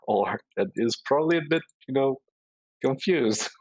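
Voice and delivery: normal talking voice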